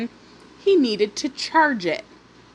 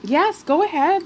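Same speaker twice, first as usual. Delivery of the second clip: higher, bright eager "Yes, go ahead."; gladly polite; 7 semitones higher than usual. Retold or imitated. Parents gladly granting the water request, higher and eager. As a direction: play the overly willing polite neighbor. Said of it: "gladly been like".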